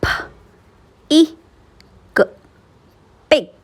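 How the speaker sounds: k, a, p, then blended into cap; sounding out words. Shown as a demonstration. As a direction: say each letter sound with pauses, then blend. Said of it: "Let's practice and blend the sounds".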